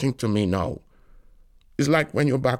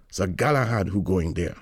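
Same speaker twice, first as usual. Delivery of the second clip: ordinary delivery, nothing marked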